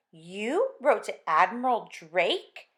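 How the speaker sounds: slow astonished ask; can't believe he wrote; amazed question. Acted. Wife amazed at letter.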